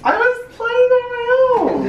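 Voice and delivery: high, slow whining excuse; whiny defence. Pretend. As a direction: whine it playfully.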